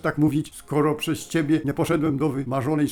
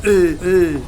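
straining groans; 3 semitones higher, 8 dB louder than usual. Acted. Scientist being sucked into the portal, loud straining grunts. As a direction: strain against the pull, grunting hard.

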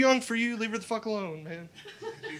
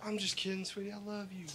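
put-on much softer, soothing the girlfriend; soft sweet reassurance; 13 dB quieter than usual. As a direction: coo it to calm her down. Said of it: "I was like".

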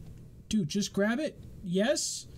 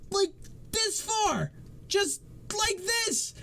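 exasperated rant; 11 semitones higher than usual. Real, not acted. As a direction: rant at the absurd game. Ranting about the spinning hammer.

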